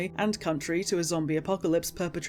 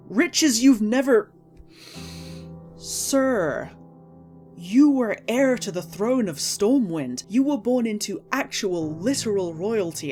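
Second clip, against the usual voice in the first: wild unhinged ranting; 3 semitones higher, 4 dB louder, slower than usual. Real, not acted. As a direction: snap back in outrage, slowly. Outraged rebuttal to Anduin.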